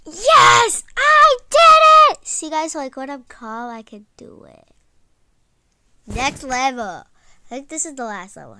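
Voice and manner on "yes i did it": loud, high victory scream; triumphant shout. Real, not acted. Player beats the level at last, triumphant scream. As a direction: shout it out, drawn out and overjoyed.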